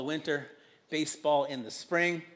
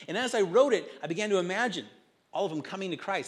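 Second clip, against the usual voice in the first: ordinary delivery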